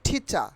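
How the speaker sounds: ঠিচা with puffed T and no R at the end; short ঠিচা, ending on an open "cha"; aspirated T, silent R. Shown as a demonstration. Teacher modelling the correct word, aspirated T, silent R. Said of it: "আমরা টিচারের উচ্চারণ করব".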